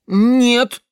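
put-on loud, high childish protest; displeased refusal. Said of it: "недовольно замотал головой парнишка". (недовольный громкий отказ)

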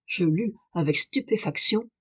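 usual speaking voice

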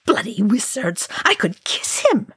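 wild jubilation climbing high; gibbering wildly. A narrator's reading. Dwarf overjoyed at the wizard's victory, gibbering wildly. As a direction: burst out, rising into giddy delight. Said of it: "Oin gibbered wildly".

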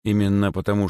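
ordinary delivery

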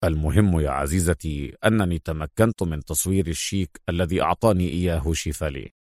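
low-pitched, assured hero; self-assured secret agent. A narrator's reading. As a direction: recount the trick in a low voice. (صوت بطل منخفض واثق)

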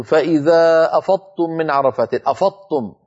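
quick "أفضتم", ض lost into the following consonant; wrong pronunciation. Shown as a demonstration. Tajweed teacher showing listeners a common mistake, mispronounced. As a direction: say "أفضتم" with the ض melted into a doubled emphatic ط. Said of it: "هذا نطق خاطئ".

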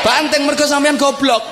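high-pitched outburst; mock furious shout. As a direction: yell it as a comic scolding. Mock outburst at broken radio owner. (bentakan marah pura-pura)

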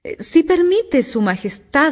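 hesitant, high humble appeal. A narrator's reading. Two kneels to answer the Queen, very humbly. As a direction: humbly beg permission, starting high. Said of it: "con tono muy humilde".

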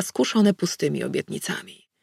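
plain reading voice

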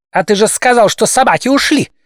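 high, eager objection; pushy interjection. A narrator's reading. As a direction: cut in keenly, pitch up high. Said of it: "ввязался неуёмный Мокроусов".

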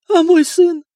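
high, loud desperate question; eager anxious question. A narrator's reading. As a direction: grab at hope for her son. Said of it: "жадно спросила она".

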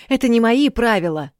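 loud, high angry outburst. Acted. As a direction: flare up and shout it. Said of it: "взвился принц". (вспылив, на крике)

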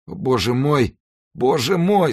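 high, pained wail; anguished moaning. A narrator's reading. Dying executioner to his confessor, moaning high. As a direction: moan it like a man in agony. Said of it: "стонал палач".

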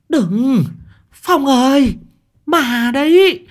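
high, loud, drawn-out eerie cry; possessed woman's call. A narrator's reading. Possessed sister stops her brother at the door, wailing eerily. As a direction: call out slowly on a high, held pitch.

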